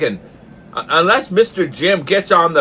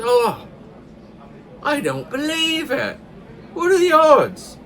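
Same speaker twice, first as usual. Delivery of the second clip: much higher, amazed Newfoundland voice; astonished Newfoundlander; 11 semitones higher than usual. Acted. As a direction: squeal the disbelief, Newfoundland style. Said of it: "a newfie joke".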